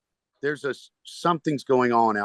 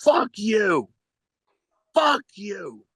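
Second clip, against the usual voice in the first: heckler yelling; 10 semitones higher, much slower than usual. Retold or imitated. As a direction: yell it like a belligerent drunk at the bar. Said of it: "some guy at the bar starts going".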